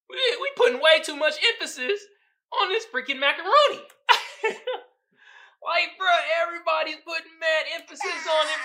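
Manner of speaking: loud, high, laughing through the words; half-shouting amused disbelief; laughing rant. Real, not acted. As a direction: laugh it off while protesting. Laughing rant about macaroni.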